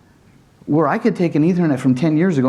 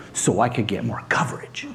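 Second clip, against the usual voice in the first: whispered confession; a guilty whispered aside; sheepish whisper; 4 semitones lower, 7 dB quieter than usual. Real, not acted. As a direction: let it slip out under your breath. Sheepishly admitting past mistakes.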